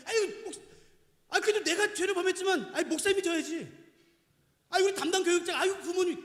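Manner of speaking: high, loud imitation of someone passing blame; fussy protesting act; mock excuse-making. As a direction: plead it off onto others, hammed up. Mimics people shifting blame onto others.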